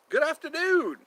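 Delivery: very high, silly hello; high-pitched playful greeting; put-on high greeting. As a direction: call it out in a high voice.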